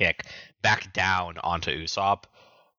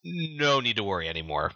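higher-pitched mock-casual bluff; acted-out false assurance; 6 semitones higher than usual. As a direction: bluff it breezily, as if the job is done. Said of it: "He's bluffing to Mister Zero".

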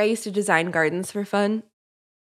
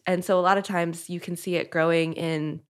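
normal talking voice

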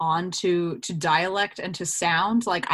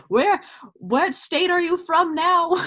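high-pitched, incredulous question; baffled questioning; 10 semitones higher, 4 dB louder than usual. Retold or imitated. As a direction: ask it in exaggerated bafflement. Voices a confused listener.

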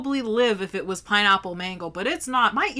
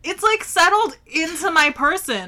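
dramatic; 7 semitones higher, 6 dB louder than usual. Real, not acted. Dramatic spice complaint.

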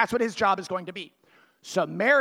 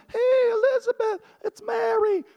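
much higher and louder, cheery knock-knock call; young Mary's voice; 14 semitones higher, 5 dB louder than usual. Retold or imitated. Mary at Elizabeth's door, calling out in a high, cheery voice. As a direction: call through the door as a bubbly young girl. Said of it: "she's like".

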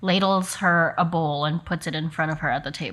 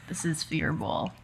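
shy grunt; 8 dB quieter than usual. Acted. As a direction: mumble it softly, too shy to say. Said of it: "grunts in your direction".

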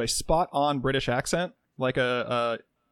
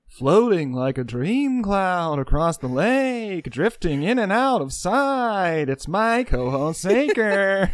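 slow, wavering singsong drawl, raised pitch; 4 semitones higher, 4 dB louder, much slower than usual. Acted. Introducing his co-host on the podcast, slow singsong impression. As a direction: stretch every word in a Liverpudlian-spiced singer impression. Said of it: "It was supposed to be [name]".

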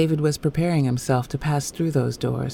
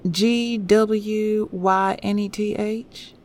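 slow, higher Southern nurse spelling aloud; gentle Southern accent; 6 semitones higher, much slower than usual. A narrator's reading. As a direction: spell it out questioningly in a Southern lilt. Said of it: "The gentle southern intonation of the nurse".

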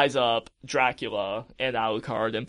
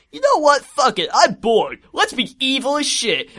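put-on high, loud, reckless teen voice; hammed-up bored rebel outburst; Alucard; 9 semitones higher, 5 dB louder than usual. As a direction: declare it loudly in a raised, cocky voice. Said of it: "Alucard's like".

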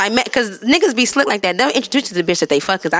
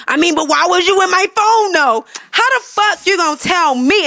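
mock indignant yell; 9 semitones higher than usual. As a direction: play the caught cheater acting offended. Imitating a cheating partner confronting her.

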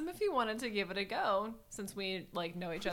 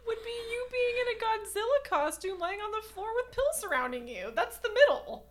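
laughing; 5 dB louder than usual. Real, not acted. Joking about the "middle" prank.

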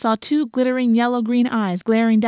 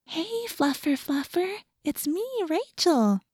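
much higher, softer, slow coaxing; talking to animals voice; 8 semitones higher, 6 dB quieter, slower than usual. A narrator's reading. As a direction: gently lure a wary cat in a high hush. Coaxing the cat closer.

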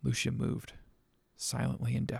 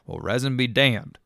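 loud, menacing dismissal; 9 dB louder than usual. Acted. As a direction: spit it out like a threat, loud. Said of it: "said Rob menacingly".

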